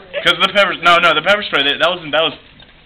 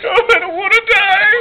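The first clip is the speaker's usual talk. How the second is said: high, whimpering fake sob; mock crying; 3 dB louder than usual. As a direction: wail like you're about to die, hammed-up. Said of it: "Act out your wreck".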